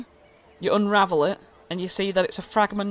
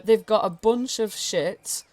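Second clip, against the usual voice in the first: usual speaking voice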